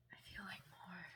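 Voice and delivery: breathy soft whisper of the phrase. Real, not acted. Reader repeating the song line to herself, whispered. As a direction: whisper it to yourself.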